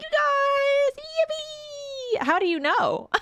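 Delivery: tipsy squeal and giggles. Real, not acted. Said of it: "Sorry, I'm a little tipsy".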